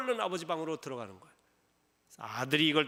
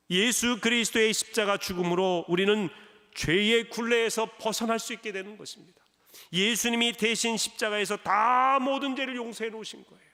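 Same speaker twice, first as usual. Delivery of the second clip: louder, rising preacher's voice; fervent preaching; 5 semitones higher, 7 dB louder than usual. Acted. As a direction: proclaim it from the pulpit. Preaches at full force. (힘차게 외치는 설교)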